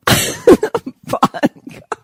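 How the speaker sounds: high, slow giggly words; laughing. Real, not acted. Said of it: "What's funny".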